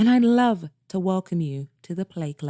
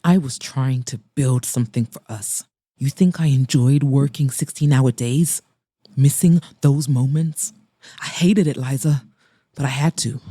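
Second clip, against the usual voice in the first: Mason, tone sharper; 5 semitones lower than usual. Acted. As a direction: lower male voice, sharp and defensive. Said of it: "his tone sharper".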